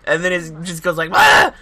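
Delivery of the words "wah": high, loud cartoon Wah; cartoon yell. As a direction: squeal it like a cartoon. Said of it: "like a cartoon".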